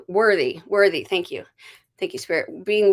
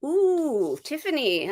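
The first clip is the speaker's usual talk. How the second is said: ordinary talk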